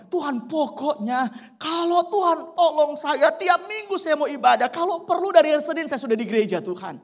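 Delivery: high, loud, pleading prayer; loud desperate prayer. Retold or imitated. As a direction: shout the promise up to God. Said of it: "Dia teriak kencang, dia doa lagi". (doa berteriak dengan nada tinggi dan keras)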